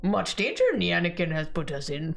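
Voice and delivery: light Yoda impression; gravely warning little Jedi. Yoda quote.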